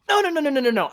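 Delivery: rapid high no's; rapid high-pitched protest; emphatic no. Real, not acted. Urgent denial of a bad plan.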